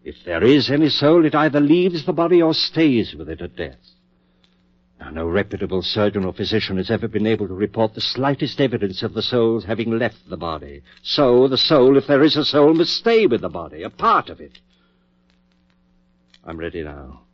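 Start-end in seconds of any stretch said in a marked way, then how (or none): 0.0-3.8 pushing the argument higher and louder; rising insistence. Acted. Surgeon insisting to his doubtful colleague, pitched up and loud. 11.0-14.6 loud, high, driving the point home; forceful conclusion. Acted. Surgeon clinching his soul argument to his colleague, loud and high.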